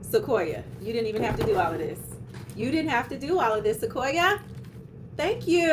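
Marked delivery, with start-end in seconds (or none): 3.8-5.7 gushing thanks. Real, not acted.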